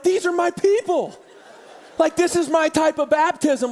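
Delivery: high, excited declaration; mock-proud delight. Pastor delights in his cussing, chewing congregation, excited declaration. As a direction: pitch it way up, gleefully claiming them. Delighted reaction to unruly baptism.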